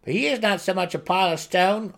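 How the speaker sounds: high, loud defensive gnome; protesting gnome. A narrator's reading. Berated gnome protests to the group, high and loud. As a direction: blurt it out to win the argument. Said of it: "he protested".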